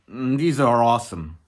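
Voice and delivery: normal talking voice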